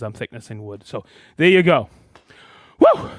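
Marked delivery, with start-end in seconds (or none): 2.8-3.1 excited whoop. Real, not acted.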